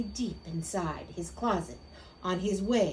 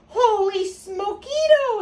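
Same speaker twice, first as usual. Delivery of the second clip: high, loud, drawn-out amazement; storybook kitten astonished at the mirror; exclaiming; 11 semitones higher, 8 dB louder than usual. A narrator's reading. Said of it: "exclaimed Skippy John Jones".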